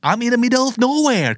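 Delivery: high-pitched, wailing complaint; distressed pleading. Acted. Stranded caller tells a friend they are lost, distressed pleading. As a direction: complain helplessly with the pitch right up.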